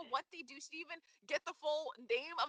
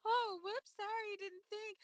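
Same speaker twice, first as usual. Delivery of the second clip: higher, light mock apology; oops-sorry in a higher voice; imagined Kiki; 7 semitones higher, slower than usual. Co-host voices Kiki brushing off Sasha, mock apology. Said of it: "Kiki would be like".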